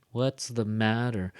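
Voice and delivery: slow, with "matter" stronger and longer; stress on matter. Shown as a demonstration. Teacher repeating the emphasis for listeners, stress on matter. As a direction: lengthen "matter" and let it fall. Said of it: "a little bit louder and a little bit stronger".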